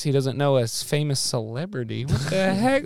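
usual speaking voice

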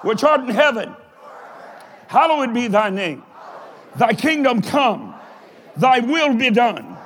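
phrase by phrase, with pauses for response; leading group prayer. Shown as a demonstration. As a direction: call out each phrase for them to follow. Leading congregation in the Lord's Prayer.

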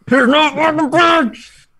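high, slow, laughing through teeth; mock a driver through clenched teeth; through his teeth. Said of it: "talking through his teeth".

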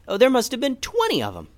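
wide-eyed narrator marvelling; excited aside. A narrator's reading. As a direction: gush over how many chickens.

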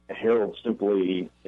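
usual speaking voice

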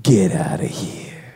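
low, gruff get outta here; low gruff dismissal. Retold or imitated. Guard dismissing the narrator, low and gruff. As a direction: growl him away. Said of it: "And he goes".

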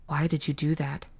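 put-on soft, subdued boy's question; hushed reproach. Third boy questions his reviver, hushed reproach. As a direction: ask it quietly, darkly.

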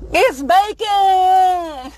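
high, drawn-out mock commercial dog; excited dog-ad voice. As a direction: stretch it out high like an eager ad dog. Mimics dog treat commercial.

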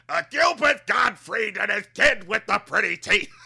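loud, slow, laughing villain yell; mocking the Fairly OddParents villain, loud and laughing; cartoon villain voice. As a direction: ham up the cartoon dad with a laugh.

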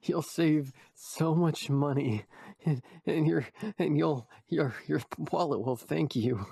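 mock sponsor pitch; ad read.

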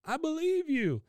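high mock-wailing boy; hammed-up devoted grandson calling back; Bobby. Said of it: "He goes".

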